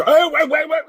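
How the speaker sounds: high, loud, rapid-fire waits; retold urgent call. Reliving the parking moment, high urgent call. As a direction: re-enact a sudden alarmed halt.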